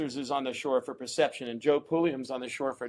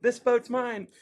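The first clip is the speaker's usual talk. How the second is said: higher and slow, pumped-up bargaining; eager plea; 9 semitones higher, slower than usual. Retold or imitated. Paddler calling to the owner before his ride, high eager plea. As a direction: stake your claim, wide-eyed and keyed up.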